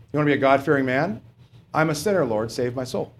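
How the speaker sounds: ordinary talk, nothing marked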